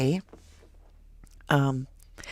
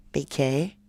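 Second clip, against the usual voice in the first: ordinary talk, nothing marked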